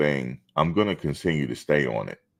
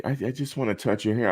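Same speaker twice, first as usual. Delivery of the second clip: mock lady voice; 8 semitones higher than usual. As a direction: play the curious lady asking sweetly. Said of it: "she was like".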